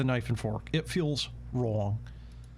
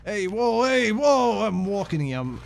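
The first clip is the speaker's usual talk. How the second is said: much higher and louder New York shout; a New York cabbie yelling at a car; hammed-up New York yell; New York accent yelling; 7 semitones higher, 4 dB louder, slower than usual. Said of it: "There we go".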